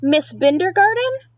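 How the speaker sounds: put-on reading to children. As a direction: read the rhymes aloud to a small child. Reads picture book aloud.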